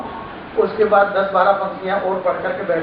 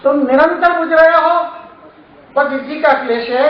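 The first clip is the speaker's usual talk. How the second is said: poem recitation. Acted. Poet urging the audience not to stay silent, rousing recitation. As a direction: declaim it like a poet stirring a crowd.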